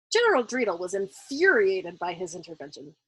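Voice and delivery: plain reading voice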